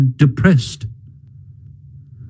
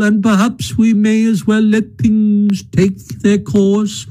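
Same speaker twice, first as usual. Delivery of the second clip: feebler Toad; 8 semitones higher than usual. A narrator's reading. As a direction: fade away as if on his deathbed. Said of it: "murmured Toad more feebly than ever".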